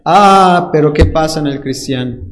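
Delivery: usual speaking voice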